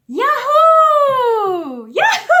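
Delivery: high, very loud whooping cheer; joyful shout. Shown as a demonstration. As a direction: throw your arms up and yell with glee. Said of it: "and shout".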